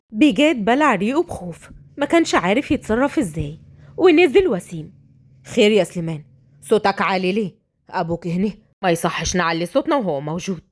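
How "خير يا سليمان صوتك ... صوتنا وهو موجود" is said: low, measured male voice; family member intervening; reproving brother. Acted. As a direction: gently scold him for the noise.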